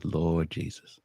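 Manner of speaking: low, soft mock dismay; dismayed registrar. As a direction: mutter it low, as if appalled by the paperwork. Registrar reacts to his transcript.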